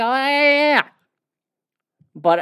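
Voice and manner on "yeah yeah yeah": high, mocking "Yeah, yeah, yeah" brushing it off. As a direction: toss it off in a high sarcastic voice. Mocking dismissive reply.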